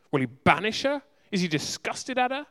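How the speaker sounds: ordinary talk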